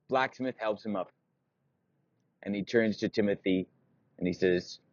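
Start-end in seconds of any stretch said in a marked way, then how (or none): none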